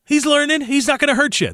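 high, chirpy imitation of a baseball scout; lightly hammed-up insider voice; mock scout exchange. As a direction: play the upbeat scout excusing a bad catcher. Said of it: "That's how they say it".

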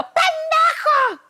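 high-pitched, stretched-out yelled insult; drawn-out high exclamation of outrage; retold outburst. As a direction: re-enact blurting a curse, shrill and slow. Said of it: "Se me salió un pendejo al aire".

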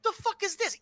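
high, baffled question; surprised. Retold or imitated. Waking up to a baffling match result. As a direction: blurt it out confused. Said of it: "You're like".

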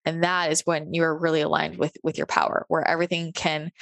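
usual speaking voice

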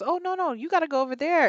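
imitating a high-pitched refusal; dismissive hospital worker. Man off shift sending her elsewhere, imitated refusal. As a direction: shoo her off in a high mimicking voice. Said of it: "And he goes".

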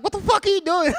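high, loud retold mom outburst; imitating a shocked mom yelling; mock mom yell. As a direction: shriek at the kid like an alarmed mom.

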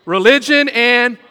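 loud prompting the congregation; loud, leading the crowd to finish; call and response. Shown as a demonstration. Preacher prompting the congregation to finish the saying, loud. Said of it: "What is it?".

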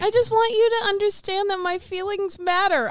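high, nasal whine; whiny. Real, not acted. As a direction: plead in a whiny voice. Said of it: "I sound so whiny right now".

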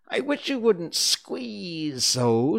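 slow, high, loud whine; drawn-out, high dormouse grumble; complaining Dormouse. A narrator's reading. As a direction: whine as if being squashed.

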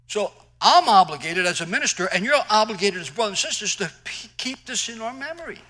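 loud, high preacher's call; preaching. Acted. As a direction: preach it out, full force. Preaching at full force.

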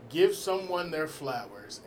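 ordinary delivery

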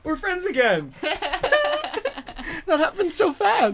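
high, loud giddy celebration; elated, laughing. Real, not acted. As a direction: squeal it through bursts of laughter. Celebrating making up, cracking up.

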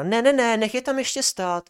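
high, loud bossy refusal; bossy lab assistant. Retold or imitated. Lab assistant telling a colleague to leave students waiting, high bossy voice. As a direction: mimic her ordering the others.

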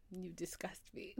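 whispered, drawn-back mock disgust; disgusted mom. Mom seeing the wrapper fall out, disgusted whisper. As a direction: imitate mom in a barely voiced whisper. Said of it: "she looked at me and she was like".

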